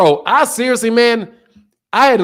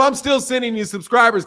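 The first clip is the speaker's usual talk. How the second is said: usual speaking voice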